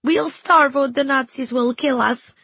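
high, loud cry of despair; despairing girl. Acted. Weeping girl to the friend holding her, despairing.

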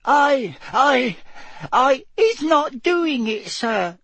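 very high, squeaky gasping elf; slow, stammering high-pitched protest; Winky. A narrator's reading. The elf denies the spell to Mister Diggory, gasping squeak. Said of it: "Winky gasped".

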